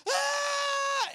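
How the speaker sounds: loud high sustained shriek; high-pitched terrified yell; wife's scream. Retold or imitated. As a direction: imitate a wife screaming in panic. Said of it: "And then my wife".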